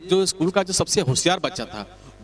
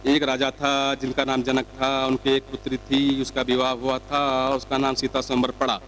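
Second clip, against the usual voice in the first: put-on slow child reading; 3 semitones lower than usual. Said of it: "किस तरह से start".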